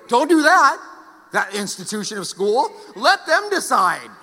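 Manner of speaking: loud mock-serious warning; sarcastic warning. Pastor mocking parents who won't force school, loud and sarcastic. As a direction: sarcastically forbid forcing school.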